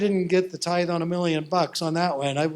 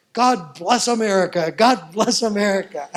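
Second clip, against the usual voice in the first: much louder, beaming with a laugh; joyful exclaim; 3 semitones higher, 8 dB louder than usual. Retold or imitated. Tina facing the live camera, joyfully loud. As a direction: proclaim it gladly to the camera.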